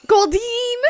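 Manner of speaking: loud, high Pokemon call; Goldeen cry. Retold or imitated. Mimicking the Pokemon's cry with a loud, high call. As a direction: belt the name like the Pokemon's cry.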